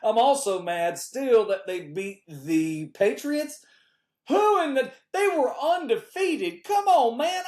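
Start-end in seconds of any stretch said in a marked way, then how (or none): 4.3-7.4 exasperated outburst. Real, not acted. Griping to the audience about the undefeated team, exasperated. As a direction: pitch jumps high in disbelief.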